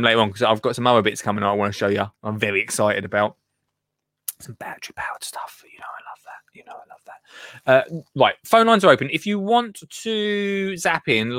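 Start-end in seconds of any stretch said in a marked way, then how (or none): 4.4-5.6 whispered aside, no voice; conspiratorial whisper about battery tools. Acted. Excited secret aside. 5.7-6.4 voiceless whisper; softly whispered confession. Acted. 6.5-7.2 voiceless whisper; softly whispered confession. Acted. Whispered again, softer.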